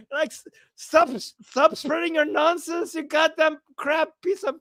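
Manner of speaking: high, furious scolding; angry outburst. Retold or imitated. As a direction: tell him off hotly. Translating insult in character.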